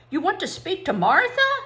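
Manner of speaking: high, loud disbelieving question; incredulous. A narrator's reading. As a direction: ask it in mock astonishment. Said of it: "in an incredulous voice".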